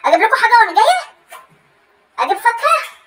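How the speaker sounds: keen, upturned phone questions; eager offering. Acted. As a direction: offer eagerly, pitch lifting at the end. Eager offer while making up by phone.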